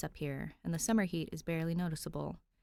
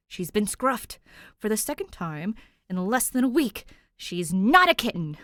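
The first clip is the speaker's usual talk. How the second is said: louder outraged narration; indignant; 4 semitones higher, 11 dB louder than usual. A narrator's reading. Narrator fuming that Danny got scruffed again. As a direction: huff it out, offended.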